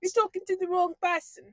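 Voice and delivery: high, put-on Boston accent. Co-host playing at a tough stranger, mock Boston accent. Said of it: "go straight to Boston".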